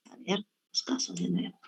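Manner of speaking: soft whisper to herself; whispered aside. Real, not acted. Teacher whispering to herself while finding the slides. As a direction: murmur it under your breath.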